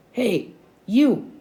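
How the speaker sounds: restrained gruff bellow, high-pitched peaks. A narrator's reading. Brad hails the kids across the playground, bellowing gruffly. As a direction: call out roughly, pitch jumping up. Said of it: "a gruff voice bellowed".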